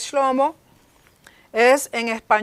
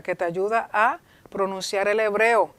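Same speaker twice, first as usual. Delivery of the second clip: normal talking voice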